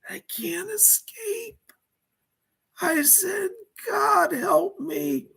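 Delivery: high whispered panic, breaking into cries; pleading in terror; frightened, near tears. Pretend. Terrified retelling of being chased.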